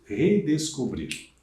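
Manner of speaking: redescobrir said slowly, every syllable clear; drawn-out teaching voice. Shown as a demonstration. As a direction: model redescobrir for the class slowly. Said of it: "Olha aqui, mais um prefixo".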